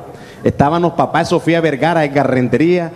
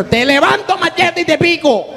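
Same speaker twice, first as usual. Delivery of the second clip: put-on old woman's high-pitched threat; acted threat; 10 semitones higher than usual. Little old lady threatening a machete, high angry outburst. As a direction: threaten them in a raised, high voice.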